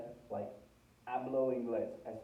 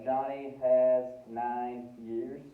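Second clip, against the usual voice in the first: slow literal translation; 7 dB louder, much slower than usual. Shown as a demonstration. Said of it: "Literalmente en inglés podría ser".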